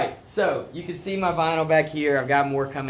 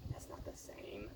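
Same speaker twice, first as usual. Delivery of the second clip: whispered teasing aside; whispering; 6 semitones lower, 24 dB quieter, slower than usual. Real, not acted.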